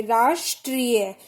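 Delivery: राष्ट्रीय said slowly, each sound clear; teacher sounding out राष्ट्रीय; careful slow pronunciation. Shown as a demonstration. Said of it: "उच्चारण करके देखिए".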